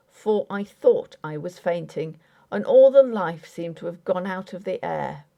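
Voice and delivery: ordinary delivery, nothing marked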